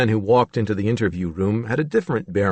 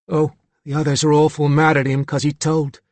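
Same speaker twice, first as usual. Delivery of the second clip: put-on young lad with a Cockney accent; Cockney accent, boyish voice; 5 semitones higher, 3 dB louder than usual. A narrator's reading. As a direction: say it as a Cockney schoolboy. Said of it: "it was a boy with a Cockney accent".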